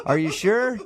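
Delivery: usual speaking voice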